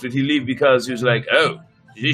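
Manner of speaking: plain reading voice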